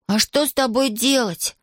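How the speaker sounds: high, slow exasperated cry. A narrator's reading. Паша jumps up at stubborn Иван, crying out. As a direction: cry the question out high, without much volume. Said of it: "закричал Паша, вскакивая".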